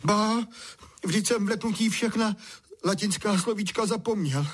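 high, upset protest; plaintive. A narrator's reading. Boy lost all his Latin in fright, plaintive. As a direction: complain in a high boyish voice.

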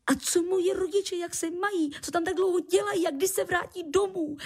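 put-on very high, eager child; spilled out in one breath. A narrator's reading. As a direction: fire off the questions impatiently, speeding up. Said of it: "vychrlil jedním dechem".

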